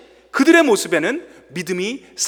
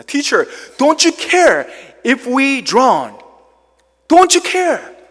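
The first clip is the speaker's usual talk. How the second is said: slow, loud, high pleading; terrified disciples shouting at sleeping Jesus; desperate cry in English; 4 semitones higher, 5 dB louder, much slower than usual. Acted. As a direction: drag out each word like a frightened man shouting. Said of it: "영어로 읽을 때 더 다가오는".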